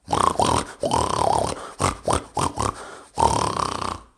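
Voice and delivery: loud low grunting snort song; pig's awful singing. Acted. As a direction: belt out a hideous pig song. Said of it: "The pig started to sing".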